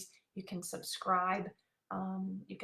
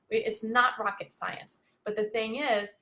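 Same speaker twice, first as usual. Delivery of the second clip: usual speaking voice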